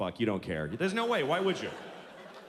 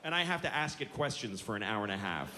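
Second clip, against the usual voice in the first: ordinary talk, nothing marked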